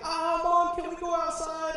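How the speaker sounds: put-on high, loud pleading kid voice; kids begging mom. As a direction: beg like a little kid asking to go play. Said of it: "And we'd be like".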